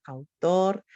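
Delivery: autor said as Spanish au-TOR; Spanish pronunciation. Shown as a demonstration. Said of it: "in Spanish, what's your word?".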